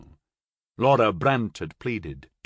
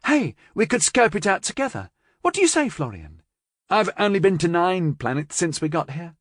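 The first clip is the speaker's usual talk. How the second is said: eager and chirpy; 7 semitones higher, 3 dB louder than usual. A narrator's reading. Carilla pitching a trip.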